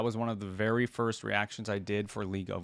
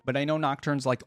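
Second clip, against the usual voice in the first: normal talking voice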